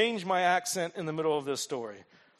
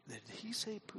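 stunned whisper; 3 semitones higher, 18 dB quieter than usual. Pretend. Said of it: "you're gonna be like".

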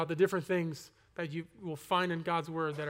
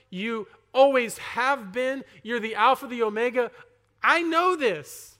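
louder, rising insistence, slowing at the end; pleading his case to God; earnest insistence; 3 semitones higher, 6 dB louder than usual. Retold or imitated. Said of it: "I'm like".

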